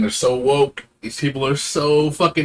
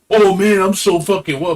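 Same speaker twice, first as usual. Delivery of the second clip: higher, louder sarcastic fan voice; mocking latecomer; 8 semitones higher, 5 dB louder than usual. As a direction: sneer it as a clueless newcomer. Mocking late converts.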